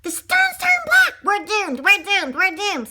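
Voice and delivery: high, loud panicked cry. Acted. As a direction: shout it as if all is lost. Panicked character cries doom.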